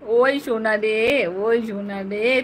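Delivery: mother cooing at her little son, slow and soft; tender coo. Real, not acted.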